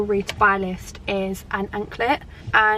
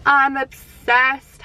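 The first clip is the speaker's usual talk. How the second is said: excited gushing; 4 semitones higher, 5 dB louder, much slower than usual. Real, not acted. Gushing over new watch strap.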